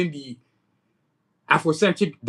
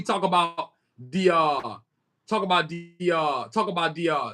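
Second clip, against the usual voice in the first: stumbling over the phrase; real hesitant stutter. Real, not acted. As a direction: get stuck restarting, with drawn fillers. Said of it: "I be stuttering".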